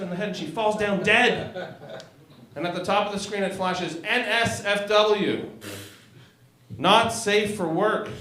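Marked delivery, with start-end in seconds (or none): 4.0-8.1 slow announcement. Acted. Reading screen text slowly.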